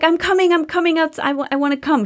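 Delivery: put-on a light little-girl voice saying she's coming out; eager little girl. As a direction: sound like a keen kid begging to come along. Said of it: "She's like".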